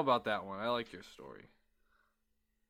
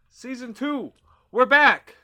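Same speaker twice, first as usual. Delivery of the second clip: excited announcer; 12 semitones higher, 16 dB louder, slower than usual. Acted. Announcing the new season.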